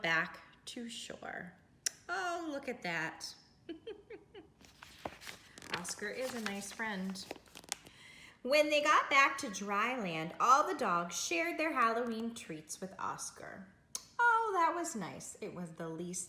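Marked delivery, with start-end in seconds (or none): none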